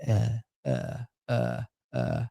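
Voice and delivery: low, soft drawn-out uhs; mock dumb uhs. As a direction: imitate your own dopey filler sounds. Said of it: "I watch 'em like I am".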